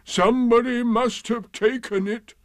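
high, mournful donkey voice; Eeyore, aggrieved. A narrator's reading. Donkey suspecting theft of his tail, aggrieved and high. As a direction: complain dolefully, pitched high. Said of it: "said Eeyore".

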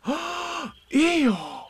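high, drawn-out gasped cry; gasp, then exclaim it high; shocked exclamation. A narrator's reading. Everybody reacting to Eeyore's bounce, shocked cry. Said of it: "said everybody".

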